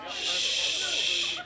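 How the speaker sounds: shushing. Real, not acted. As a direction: hush the room. Quieting a crowd of students.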